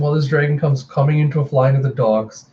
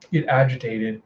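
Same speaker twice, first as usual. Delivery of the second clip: usual speaking voice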